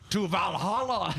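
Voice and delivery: high Viking war cry; Viking battle cry. Retold or imitated. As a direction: shout it like a Viking diving in. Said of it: "and he goes".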